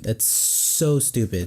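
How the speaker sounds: slow, drawn-out disgust. Real, not acted. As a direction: stretch out so stupid. Slow emphatic dismissal.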